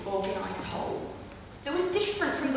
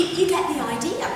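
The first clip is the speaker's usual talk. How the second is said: ordinary delivery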